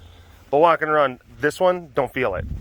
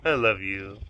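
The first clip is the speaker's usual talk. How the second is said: tender; 5 semitones lower, 5 dB quieter than usual. Real, not acted. Tender words to dog.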